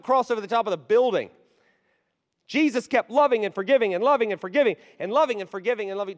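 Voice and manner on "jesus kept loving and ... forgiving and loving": forceful preaching. Acted. Same refrain preached with force.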